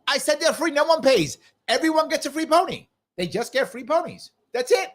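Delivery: high, insistent mock yell; Vermin Supreme yelling. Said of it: "He just yelled at them".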